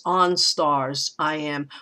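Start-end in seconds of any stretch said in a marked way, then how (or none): none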